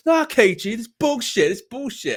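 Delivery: high, loud indignant mimic; hammed-up mate complaining; mock Flex protest. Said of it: "You'll go".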